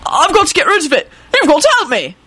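high-pitched frantic plea; desperate screeching. Acted. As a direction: beg in a panicked high screech. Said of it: "he screeched violently".

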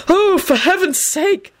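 high, drawn-out exasperation; strident outburst. A narrator's reading. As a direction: burst out stridently. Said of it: "Dumbledore burst out stridently".

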